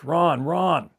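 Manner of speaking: high, loud call of the name twice; retold yelling. Frightened sibling shouting for brother downstairs, loud yell. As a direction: shout for him from upstairs. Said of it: "yelling at the top of my voice".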